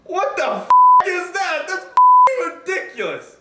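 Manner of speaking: loud, high, disgusted outburst; outraged shout. Real, not acted. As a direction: yell it, appalled. Outraged at the cicada names.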